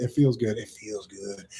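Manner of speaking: low, fading away very soft; savouring murmur. Real, not acted. Enjoying a new affirmation, low savouring murmur.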